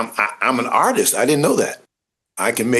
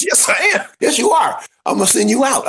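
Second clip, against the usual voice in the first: much higher, half-shouted yes; excited insisting; 11 semitones higher than usual. Retold or imitated. Casting lady insisting he's a natural, high and half-shouting.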